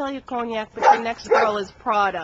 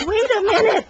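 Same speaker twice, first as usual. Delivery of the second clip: high, loud squeaky bird voice, startled; a pet bird greets the owner, high and loud; Bling's high voice; 10 semitones higher, 9 dB louder than usual. Acted. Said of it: "She's like".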